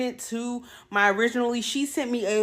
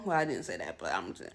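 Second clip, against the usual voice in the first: quiet aside; 9 dB quieter than usual. Real, not acted. Aside correcting herself.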